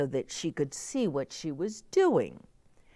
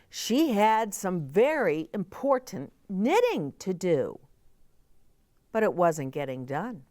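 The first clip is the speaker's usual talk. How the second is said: usual speaking voice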